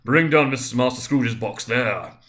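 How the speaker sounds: loud, slow, stern bellow; terrible booming voice. A narrator's reading. The schoolmaster calls from the hall, loud terrible bellow. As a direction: roar it down the hall. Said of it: "A terrible voice in the hall cried".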